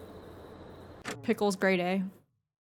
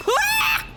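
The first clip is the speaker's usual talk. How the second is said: high shriek of fright; sudden scared yelp; startled scream; 4 dB louder than usual. Real, not acted. Jump scare.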